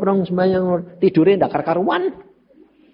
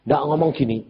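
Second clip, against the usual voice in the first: ordinary delivery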